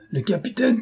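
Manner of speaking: high, strangled LE CAPITAINE; stifled. A narrator's reading. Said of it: "prononcé d'un accent si étouffé".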